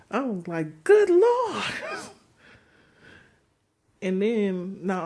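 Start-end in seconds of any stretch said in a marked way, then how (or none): 0.8-1.6 much higher, louder, put-on cry; shocked exclamation. Reacting to the woman's buttery teeth, high shocked cry. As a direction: gasp out good Lord in horror.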